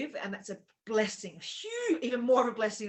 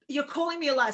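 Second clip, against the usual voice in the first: indignant; 6 semitones higher, 7 dB louder than usual. Retold or imitated. Arguing with motel manager.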